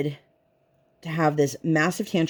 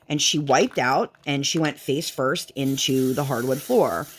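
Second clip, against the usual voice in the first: normal talking voice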